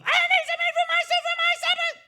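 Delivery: put-on old woman shouting. Old lady demanding the grocer open, very high shout. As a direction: yell it at the door. Knocks louder, calls louder.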